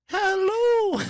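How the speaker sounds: put-on very high, loud old-nanny hello; Miss Doubtfire. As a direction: sing out a piercing falsetto greeting. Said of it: "I guess I could do Miss Doubtfire".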